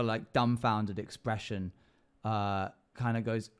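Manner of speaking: ordinary delivery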